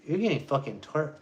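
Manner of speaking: ordinary delivery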